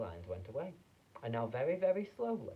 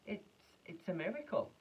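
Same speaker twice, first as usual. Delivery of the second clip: amazed gasp; 8 semitones higher than usual. A narrator's reading. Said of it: "gasped Grandpa Joe".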